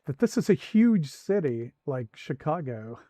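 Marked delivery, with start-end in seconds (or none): 0.1-1.7 amazed. Real, not acted. Laughing realization of big city.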